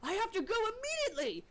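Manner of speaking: a touch of panic, rushing off; sudden alarm. Acted. Hubert jumping up to leave, mock startled. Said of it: "he says something to the extent of".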